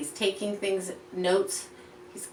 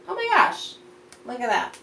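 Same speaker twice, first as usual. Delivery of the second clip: mock amazement; 6 semitones higher, 5 dB louder than usual. Teacher shows book to children.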